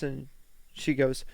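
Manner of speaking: ordinary talk, nothing marked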